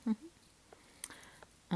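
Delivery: ordinary talk, nothing marked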